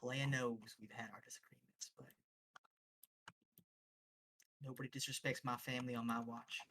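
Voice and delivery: soft, halting apology turning resolute; quiet, understated family loyalty; protective mother, subdued. Acted. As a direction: speak as a reserved mother, with long pauses. Game master voices Ruby's mom.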